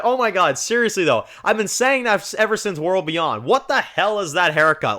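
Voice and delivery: high, exasperated disbelief; exasperated rant. Retold or imitated. Reacting to Rick's haircut, high exasperated rant. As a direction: vent about the haircut.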